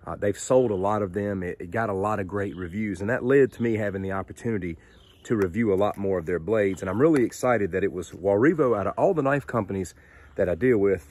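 ordinary talk

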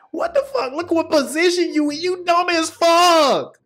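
exasperated yell. Real, not acted. Scolding the fighter's bad position, high exasperated yell. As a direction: yell it at the screen, fed up.

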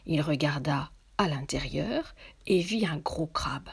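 plain reading voice

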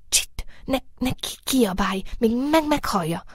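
stammering, anxious shush; frightened hushing. A narrator's reading. Rabbit begs moonbeam not to shout, stammering fearfully. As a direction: hush urgently with a fearful stutter.

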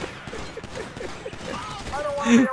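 long, soft wheezing laugh; quiet, high wheeze of laughter; helpless laugh. Real, not acted. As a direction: laugh almost without voice. Laughs after the mishap.

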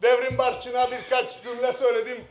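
ordinary delivery

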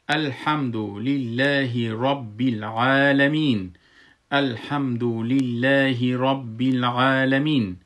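slow melodic recitation of الحمدو لله رب العالمین; recitation drill. Shown as a demonstration. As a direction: recite slowly, building phrase by phrase. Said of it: "ایتو بگوین".

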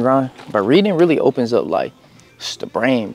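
ordinary talk, nothing marked

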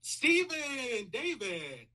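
high, loud drawn-out name call. Real, not acted. Speaker greets a childhood favorite, loud high call-out. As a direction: call the name out, then let it fall.

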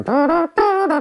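ordinary talk, nothing marked

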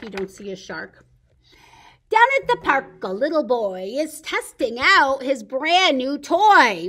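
loud, lively picture-book reading; storytime reading. Acted. Librarian reading Shark in the Park to kids, lively storytime voice. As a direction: read it big for the kids at storytime.

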